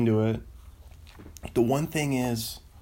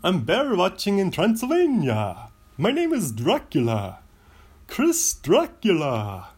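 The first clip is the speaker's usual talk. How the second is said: put-on hammy, high-swooping vampire accent; over-the-top mock Transylvanian count, drawing out words; Dracula voice; 8 semitones higher, 4 dB louder, slower than usual. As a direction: play a slow, theatrical vampire, relishing every word. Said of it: "All right, here it is".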